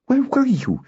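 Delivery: put-on very high, testy girl's demand. A narrator's reading. Noella confronts Kindon on his return, testily. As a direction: scold a latecomer testily in a high voice. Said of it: "Noella asked testily".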